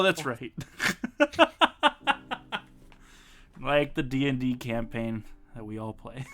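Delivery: normal talking voice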